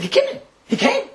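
high, disbelieving repeat; can't believe it, pitched high; incredulous echo. Retold or imitated. Rebbe hearing his son arrived, high and disbelieving.

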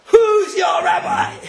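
high, loud, drawn-out challenge; mock shout. Passenger turning on the man who scolded him, mock shouting. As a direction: bellow it slowly like an outraged heckler. Passenger snaps back, acted loudly.

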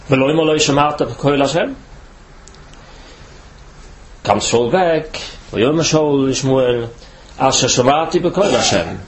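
usual speaking voice

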